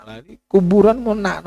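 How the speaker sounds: usual speaking voice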